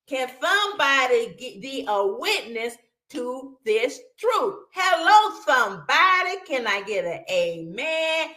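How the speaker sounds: high, loud preaching call that eases off toward the end; imitating the preacher. Retelling her old community preacher to viewers, hammed-up preacher call. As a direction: rouse the congregation like that preacher. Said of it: "I wanna say like him".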